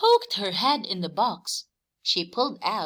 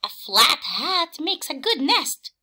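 high, louder clucking voice; clucking hen; 7 semitones higher, 4 dB louder than usual. A narrator's reading. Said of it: "clucked Hen".

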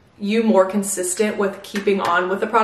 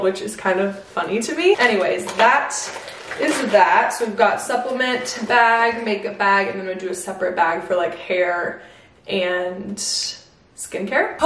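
usual speaking voice

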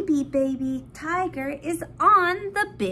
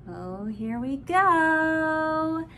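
normal talking voice

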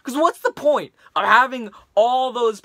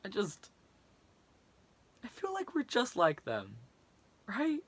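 much softer, broken and hushed; defeated, quiet; 9 dB quieter than usual. Acted. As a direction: sink into weary despair. Rant collapses into resignation.

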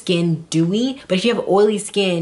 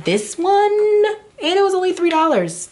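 excited squeal; 12 semitones higher than usual. Real, not acted. Excited about cheap bronzer.

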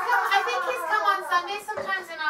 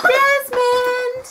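loud call across the house; 8 semitones higher, 12 dB louder than usual. Real, not acted. Mom calling her son across the house, loud call. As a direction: call him from the other room.

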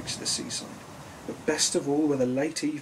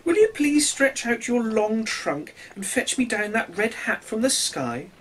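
higher, louder fish voice calling up; little fish asking; 7 semitones higher, 3 dB louder than usual. A narrator's reading. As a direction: plead with the elephant. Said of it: "The little fish called out".

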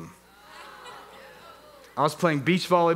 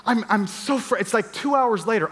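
normal talking voice

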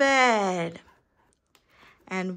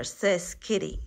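plain reading voice

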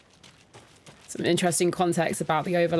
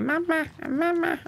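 high, slow mock baby calling; creepy baby voice; 11 semitones higher than usual. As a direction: imitate a spooky toddler calling out. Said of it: "I just don't want a baby to go like".

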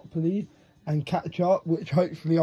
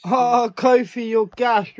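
excited; 6 semitones higher, 9 dB louder, slower than usual. Real, not acted. Wrestler in trouble.

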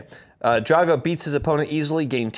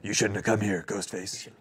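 low, menacing cultist growl; Will Arnett voice; 5 semitones lower, 7 dB quieter than usual. Acted. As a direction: threaten them in a low voice. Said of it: "you do a pretty good Will Arnett".

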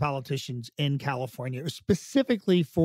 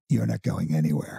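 lower, gruff insistence; low Baldwin voice; 9 semitones lower than usual. Retold or imitated. Impersonator quoting Baldwin stopping him from leaving, low and firm.

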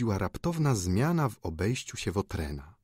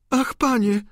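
put-on very high, louder young lady's appeal; pleading cry; 7 dB louder than usual. A narrator's reading. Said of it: "zawołała Wiktoryna, składając ręce". (błagalny, wysoki okrzyk)

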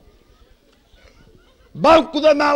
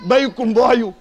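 usual speaking voice

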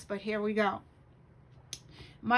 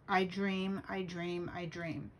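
fading to a whisper; 3 semitones lower, 7 dB quieter than usual. Shown as a demonstration. Repeated poem line fading out.

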